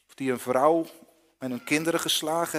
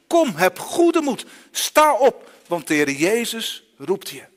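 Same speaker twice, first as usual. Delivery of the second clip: much higher, louder, slow encouraging call; calling out encouragement; 5 semitones higher, 9 dB louder, slower than usual. Acted. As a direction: call across the crowd to lift him up. Crowd calls the blind man.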